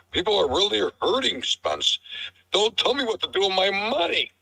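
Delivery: high, hammed-up angry troll; shill voice. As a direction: play a whiny furious shill. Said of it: "I'm a shill".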